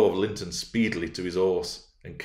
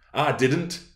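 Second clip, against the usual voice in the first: high, quick protest; raised, offended denial; indignant cry; 6 semitones higher than usual. Acted. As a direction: deny it hotly. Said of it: "she cried".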